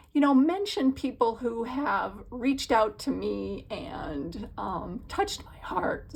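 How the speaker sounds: usual speaking voice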